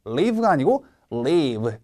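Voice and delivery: short "live" set against a long, drawn-out "leave"; long vowel leave. Shown as a demonstration. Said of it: "길게 당겨주셔야 합니다". (leave의 '이' 소리를 길게 당겨 발음)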